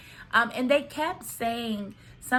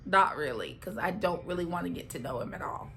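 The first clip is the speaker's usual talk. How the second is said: low, quieter, uninterested reply; awkward reluctance; 4 semitones lower, 4 dB quieter, faster than usual. Retold or imitated. Alyssa brushing off the mom's questions, awkwardly reluctant. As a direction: imitate an awkward brush-off, pitch dropped. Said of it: "Alyssa kinda awkwardly looks at her".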